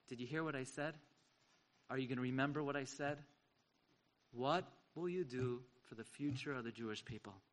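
a rabbi quietly confronting each student face to face; intimate quiet question. Retold or imitated. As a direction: press it gently into each boy. Rabbi speaking to each boy.

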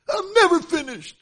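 high, loud defiant retort; defiant teen. Retold or imitated. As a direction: fire it back like a stubborn girl.

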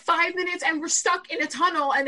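high-pitched, loud panic; panicked pleading to brother, voice way up; freaking out. Retold or imitated. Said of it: "all of a sudden I start freaking out".

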